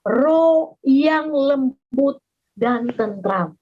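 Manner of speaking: roh yang lembut dan tentram drawn out very slowly; slow emphasis. Acted. Preacher stressing the key phrase to the congregation, slowly.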